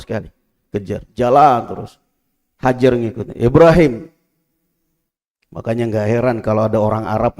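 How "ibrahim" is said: calling out. Acted. Hajar calls after husband.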